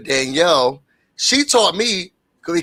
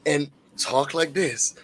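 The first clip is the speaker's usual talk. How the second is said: soft mentor voice; 4 semitones lower, 7 dB quieter than usual. Retold or imitated. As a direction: ease the words out gently. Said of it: "she taught me".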